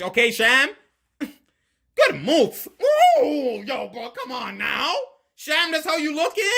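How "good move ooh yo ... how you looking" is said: high, laughing excitement; drawn-out, high-pitched delight; amazed hype. Real, not acted. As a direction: whoop and gush over the move. Amazed by a player's move.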